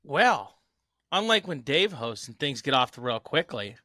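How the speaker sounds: ordinary talk, nothing marked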